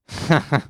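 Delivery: short, high-pitched laugh; brief burst of laughter; amused laugh. Real, not acted. Amused after remark about mom.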